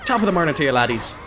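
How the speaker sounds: a hint of a mock Irish accent; mock Irish streamer greeting. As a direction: lightly ham up an Irish greeting. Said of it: "The voice".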